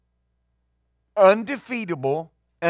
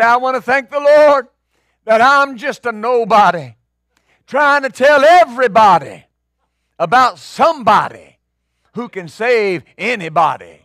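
shouted, higher church testimony; loud testimony; 4 semitones higher, 9 dB louder than usual. Retold or imitated. Preacher mimicking a fervent testifier, loud and high. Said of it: "they'll say".

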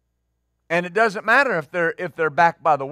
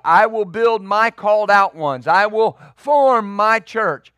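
forceful preaching; 5 dB louder than usual. Acted. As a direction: proclaim each phrase with full force. Preaching the verse at full force.

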